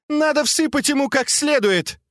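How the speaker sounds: very high-pitched furious order; angry cry. A narrator's reading. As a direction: order a thrashing in a heated, high voice. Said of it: "закричала Фэнзе".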